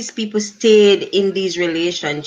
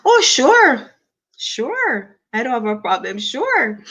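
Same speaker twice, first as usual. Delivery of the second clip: eager agreement; 6 semitones higher than usual. Real, not acted. Host agreeing to a viewer's request, high and eager. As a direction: brightly say yes at once. Asked to share live to Facebook.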